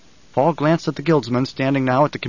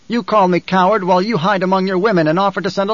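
higher, forceful accusing challenger; Feyd-Rautha, defiant; 5 semitones higher, 3 dB louder than usual. Acted. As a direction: hurl the accusation in a raised pitch. Plays Feyd-Rautha issuing a challenge.

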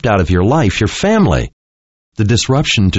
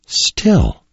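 weak voice; 7 semitones higher than usual. A narrator's reading. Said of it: "she said in a weak voice".

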